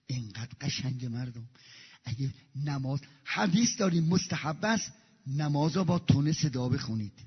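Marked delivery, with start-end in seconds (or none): none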